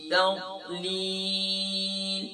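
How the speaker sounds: slow, melodic Quran recitation; measured chanted Arabic recitation; Quranic recitation. Shown as a demonstration. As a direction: recite with tajweed, drawn out. Full recitation of Surah Fil.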